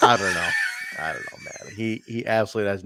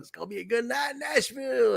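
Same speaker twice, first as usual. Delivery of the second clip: imitating a wrestling legend; 12 semitones higher, 4 dB quieter than usual. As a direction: put on a showman's high-pitched cry. Acts out Dusty's announcement.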